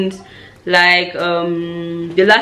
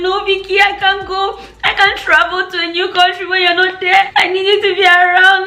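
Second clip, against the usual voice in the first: high, wailing little-sister plea; mock-tearful begging; imitating crying sister; 12 semitones higher than usual. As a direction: plead tearfully in a much higher voice. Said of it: "my younger sister started crying".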